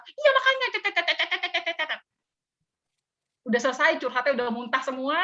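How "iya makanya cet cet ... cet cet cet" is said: high, hammed-up gushing babble; mock venting to the mentor, high and excited; excited chatter. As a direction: pour out the complaint in a higher, excited gabble.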